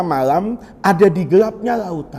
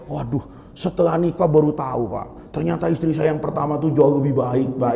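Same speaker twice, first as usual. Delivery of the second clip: soft regretful husband; 8 semitones lower, 6 dB quieter than usual. Retold or imitated.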